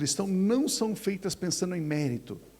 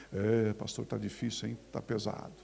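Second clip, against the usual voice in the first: lower, softer glum complaint; gloomy church member; 8 semitones lower, 7 dB quieter than usual. Retold or imitated. Church member answering the pastor's greeting, low and glum. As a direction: moan it out heavily. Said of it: "a gente cumprimenta as pessoas".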